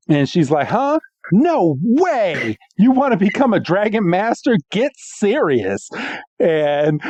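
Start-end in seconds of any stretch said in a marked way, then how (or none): 0.7-5.9 higher, scoffing disbelief; mock scoff. Georgia mocking Iris's dream, high scoffing voice. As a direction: sneer it like a snooty rival. Georgia mocks Iris, retold.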